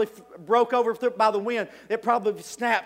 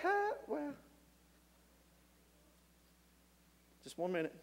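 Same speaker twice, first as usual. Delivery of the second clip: much softer, hesitant hedging; nervous stalling; 4 semitones higher, 18 dB quieter than usual. Acted. Peter hedges quietly when Jesus calls his bluff. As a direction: stall for time, losing nerve. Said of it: "Peter's like".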